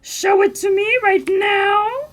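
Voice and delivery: loud, high, eager cry; eager cat fan. Retold or imitated. Cat lover begging for a cat picture, loud excitement. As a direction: demand the cat picture, thrilled. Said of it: "I'm like".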